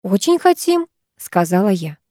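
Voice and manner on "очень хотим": high, polite keenness; put-on schoolgirl enthusiasm; feigned eagerness. A narrator's reading. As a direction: chirp agreement to please the teacher.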